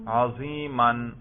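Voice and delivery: عظیماً ending in -an, "aziman"; accusative ending pronounced. Shown as a demonstration. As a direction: slowly sound out the -an on عظیماً. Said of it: "نصب پہ ذرا تلفظ کرو".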